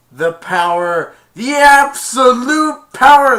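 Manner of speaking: slow, high, loud gloating; power-mad villain. Retold or imitated. Jafar exulting in his new genie power, slow loud high gloat. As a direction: savour the power like a madman.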